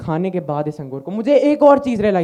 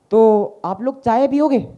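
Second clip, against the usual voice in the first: slow inviting question; slower than usual. Acted. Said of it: "आप लोग यहाँ काफ़ी देर से बैठे हो".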